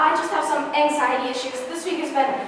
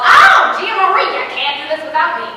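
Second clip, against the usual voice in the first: loud mocking husband voice; quoting husband; 7 dB louder than usual. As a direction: mimic him, loud and pleading. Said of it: "Franco sees the letter and is all".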